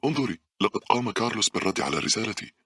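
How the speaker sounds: happy rush of news; delighted. A narrator's reading. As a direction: share the good news gladly. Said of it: "قالت باولا مبتهجة".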